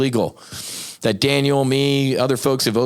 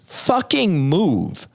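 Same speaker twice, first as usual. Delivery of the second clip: angry; 9 semitones higher, much slower than usual. Real, not acted. Angry rant at the FDA.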